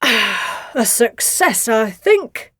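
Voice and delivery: very slow, satisfied voice; full and content after the meal; dad leaning back after a feast. A narrator's reading. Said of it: "said Nellie's dad".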